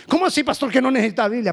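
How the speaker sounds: high-pitched, incredulous congregant; acted shocked protest; mock indignant objection. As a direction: challenge the pastor in disbelief, voice jumping up.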